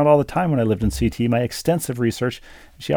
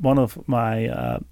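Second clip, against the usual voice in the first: normal talking voice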